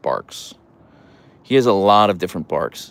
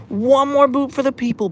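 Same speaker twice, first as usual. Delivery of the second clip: high, cooing pet voice. Real, not acted. Owner coos at her dog asking for a boop.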